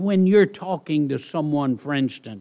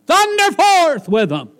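booming, louder and higher; thundering preacher; 14 semitones higher, 8 dB louder than usual. Shown as a demonstration. Pastor mocking preachers who thunder, booming. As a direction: thunder it out like a pulpit pounder. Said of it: "preachers like to take verse one".

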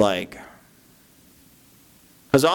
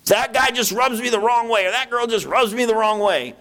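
mock complainer; 10 semitones higher than usual. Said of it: "treat each other with love".

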